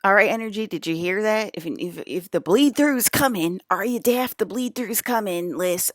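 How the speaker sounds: loud, high, exasperated scolding; angry shouting. Real, not acted. Scolding the energy for not hearing her, loud and high. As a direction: yell at the energy like it won't listen.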